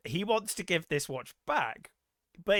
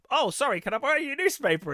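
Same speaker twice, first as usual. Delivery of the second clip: voiced character quote; 9 semitones higher, 5 dB louder than usual. Retelling film scene, character apologizes.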